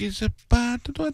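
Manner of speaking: high airy voice, sounding dumb; high ditzy mock accent. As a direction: play a sweet babbling fool. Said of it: "I want one that's gonna be like".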